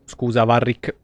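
normal talking voice

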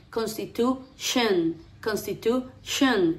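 "constitution" slowly, ending in a closed "shen"; drawn-out "constitution", last syllable separated and unrounded. Shown as a demonstration. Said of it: "Es como si fuera una E".

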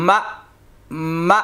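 মা cut short with a light glottal catch; slight push. Shown as a demonstration. Teacher drilling mim hamza zabar, slight push on the stop. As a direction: give each মা a small jolt at the end. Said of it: "হালকা একটু ধাক্কা হবে".

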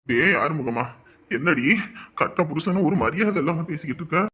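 low, soft sickly complaint; feverish weak voice. Acted. Feverish husband scolding wife from bed, low and weak. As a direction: groan it out from the sickbed. Sick husband answers weakly.

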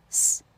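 s as a bare hiss with no vowel; pure unvoiced s; voiceless consonant. Shown as a demonstration. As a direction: hiss s alone, no voice behind it. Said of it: "发成清辅音".

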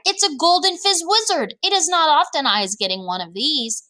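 starts very high, then settles; thrilled storybook giant; excited cry. A narrator's reading. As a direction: exclaim it high, like a rare prize. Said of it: "he cried".

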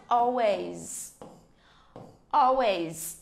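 "always" with AL stressed, said slowly; stressed first syllable. Shown as a demonstration. Teacher drills "always" for the class, stressing AL. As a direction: lean on AL in "always". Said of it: "nhấn mạnh vô giùm cô, all, nhấn chỗ dấu sắc".

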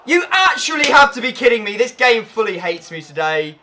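frustrated outburst. Real, not acted. Frustrated by missed chance.

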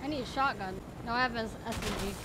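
usual speaking voice

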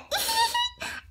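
silly high-pitched giggle. Acted. Reader giggles at the donut pun, high and silly. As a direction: giggle high at your own joke.

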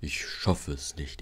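low, soft, slow, laboured; weary straining puff. A narrator's reading. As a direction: heave it out like a tired big engine. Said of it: "schnauften sie gemeinsam".

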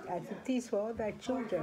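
ordinary delivery

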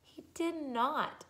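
put-on high, slow child voice; little sister. Sister contradicting the crybaby, high child voice. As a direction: draw it out in child pitch.

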